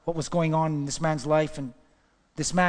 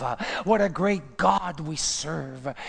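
ordinary talk, nothing marked

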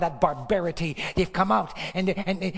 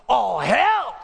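shouted; 5 semitones higher, 7 dB louder than usual. Real, not acted. Preacher's climax on judgment.

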